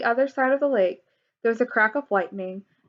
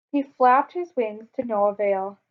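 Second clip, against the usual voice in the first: ordinary delivery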